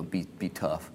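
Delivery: ordinary delivery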